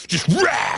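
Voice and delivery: mock battle cry. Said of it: "those huge battles".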